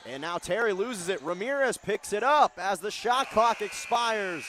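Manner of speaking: building to a loud, high buzzer call; excited commentator's climbing call; urgent play-by-play. Real, not acted. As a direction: raise it as the clock runs out. Live call as clock runs out.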